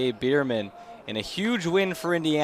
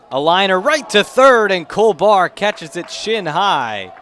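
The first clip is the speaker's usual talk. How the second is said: sudden louder, higher burst on the liner; excited call; 5 semitones higher, 8 dB louder than usual. Real, not acted. Announcer calling the liner to his listeners, excited. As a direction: call the catch as it happens. Sharp liner caught at third.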